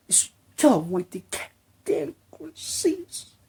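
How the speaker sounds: high, slow whispered complaint; whispered plea. Acted. Fan begging Kojima to stop cutscenes, whispered.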